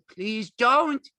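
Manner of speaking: loud, very high plea; panicked begging. A narrator's reading. Lenny begs the screaming woman, panicked. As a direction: beg in a panic. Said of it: "he begged".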